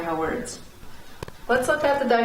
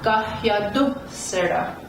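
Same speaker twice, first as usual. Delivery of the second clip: "Gahyadu será" with du lifted; emphasis on "du". Shown as a demonstration. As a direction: punch du in "Gahyadu será", then drop. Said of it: "Where do you hear the emphasis".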